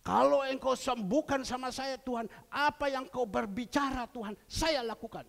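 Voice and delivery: loud, high-pitched desperate prayer, acted out; impassioned vow. Sick man begging God for healing, impassioned loud vow. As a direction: cry out a bargain to God at full voice.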